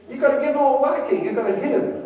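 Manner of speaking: loud, high scolding yell; angry grandmother. Retold or imitated. Grandmother shouting at her daughter, loud and high. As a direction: bark the order to hit him. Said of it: "I can't do a Northern English accent".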